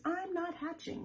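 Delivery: high, firm little egg voice; a hint of a pouty egg; stubborn egg. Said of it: "and the egg says".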